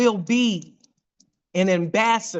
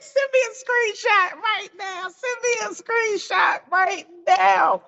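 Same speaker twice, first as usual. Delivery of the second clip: excited Todd; 11 semitones higher than usual. Retold or imitated. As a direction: squeal it like he can't believe it. Quoting Todd's excited demand.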